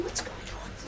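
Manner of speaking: hushed, voiceless murmur; crowd whisper. Acted. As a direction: whisper it like a puzzled onlooker. Worried crowd murmuring in the room.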